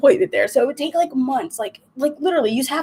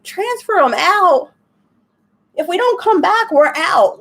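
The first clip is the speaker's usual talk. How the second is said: high, slow, disbelieving repeat of their words; incredulous retort; 7 semitones higher, slower than usual. Retold or imitated. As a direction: throw their words back at them, amazed. Retold disbelief at the clinic.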